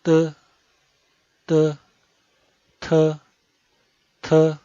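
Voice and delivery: t with a puff of air; aspirated t. Shown as a demonstration. Teacher drills aspirated t. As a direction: let air out on the t. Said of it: "También sale un aire".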